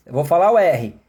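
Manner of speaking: ordinary delivery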